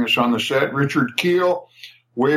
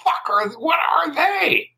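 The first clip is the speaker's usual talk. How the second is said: incredulous, rising to a much higher, half-shouted what are they; baffled high exclaim; 11 semitones higher than usual. Retold or imitated. Spielberg across the lot gaping at two bearded writers, stunned. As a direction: act stunned at these strange guys. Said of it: "looking at us like".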